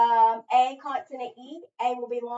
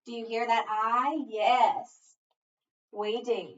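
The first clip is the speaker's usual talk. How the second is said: ordinary talk, nothing marked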